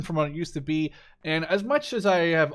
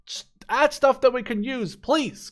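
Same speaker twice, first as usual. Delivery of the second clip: pleading; 8 semitones higher, 3 dB louder than usual. Real, not acted. Pleading to developers.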